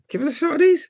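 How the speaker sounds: high-pitched keen request; squeaky imitated enthusiasm; mock eagerness. Voicing the family's eager reaction.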